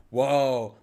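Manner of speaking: mock amazed "whoa", drawn out and loud; retold amazement. Cafe customers shown the sugar they drink, mock amazed. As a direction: react as if stunned, in a retelling.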